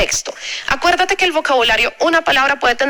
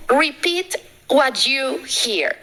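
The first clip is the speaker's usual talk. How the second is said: cada palabra en inglés dicha despacio y clara; inglés lento y claro; much slower than usual. Acted. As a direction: lee el consejo en inglés como para alumnos. Tip anunciado en inglés. (inglés pausado de profesora)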